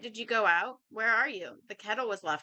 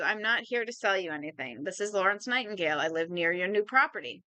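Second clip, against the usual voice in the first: ordinary delivery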